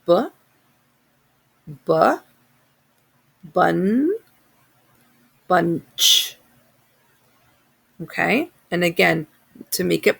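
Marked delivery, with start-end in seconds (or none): 0.1-6.4 bunch built up sound by sound: B, bu, bun, bunch; sounding out words. Shown as a demonstration. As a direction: stretch out bunch one sound at a time. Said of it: "let's sound out the word bunch".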